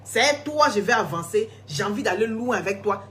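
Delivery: ordinary delivery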